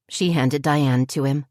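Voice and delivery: ordinary delivery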